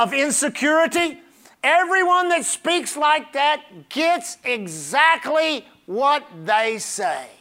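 slow, deliberate pulpit list; preaching warning. Real, not acted. Preacher warning about confessions of lack, deliberate preaching. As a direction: land each item separately, with weight.

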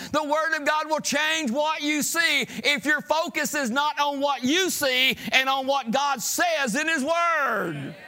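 high, loud, driving sermon delivery; full-force preaching. Real, not acted. Preacher challenging church about their words, high full-force preaching. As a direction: raise it to an insistent preacher's shout.

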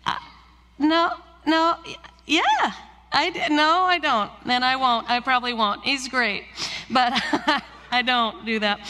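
high, stammering mock fluster; comically flustered reply; flustered backtracking. Retells own awkward answer to lady.

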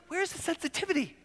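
high, hushed mock indignation; playfully wounded protest; affronted listener. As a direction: complain in a small, high voice.

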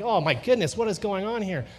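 retold yelp of disbelief; alarmed bewilderment. Dad getting jabbed in the neck, bewildered.

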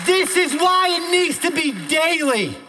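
high, loud declamation in driving bursts; full-force preaching. Real, not acted. Preacher declaring who God is to the congregation, full-force preaching. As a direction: proclaim each title of God at full pitch.

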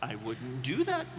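a hint of a calm mother; mother's warning. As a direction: caution the child quietly. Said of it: "mother says".

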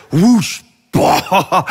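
loud whoosh and burst noise; explosive mock sound effect; imitated sudden revelation. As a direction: mime a dramatic blast with the voice. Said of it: "where it's like".

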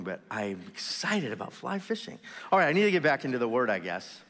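normal talking voice

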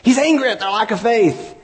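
high-pitched mock preaching proclamation; old preaching self. Preacher quoting his own mistaken sermon, mock-fervent. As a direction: declaim it high, like an overzealous preacher. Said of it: "I preached that one time".

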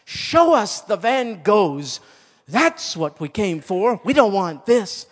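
high, loud crowd protest; outraged heckler shouting demands at an auctioneer; indignant shout. Acted. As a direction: shout it out for the whole angry room. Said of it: "shouted out".

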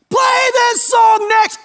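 very high, drawn-out holler; yelling. Acted. Rude audience member at the symphony, yelling. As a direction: bellow a request at the stage. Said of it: "somebody next to you starts yelling".

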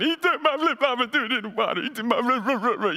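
put-on high whiny little girl wail; mock crying child. Pastor imitates his daughter crying over a flip-flop, whiny child voice. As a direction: imitate a five-year-old sobbing about her flip-flop. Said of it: "Bailey starts crying".

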